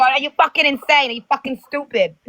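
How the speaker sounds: teasing impression of an indignant rant; mock outburst. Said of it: "you go".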